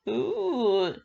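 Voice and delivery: loud, high mocking ooh; a villain's delighted sneer of a sound; gloating ooh. Basta finding Maggie at the door, gloating loud ooh.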